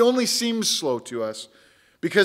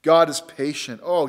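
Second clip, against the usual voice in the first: ordinary delivery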